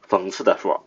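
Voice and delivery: usual speaking voice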